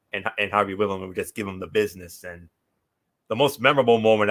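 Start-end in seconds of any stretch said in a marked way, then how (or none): none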